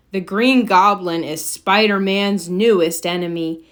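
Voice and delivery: plain reading voice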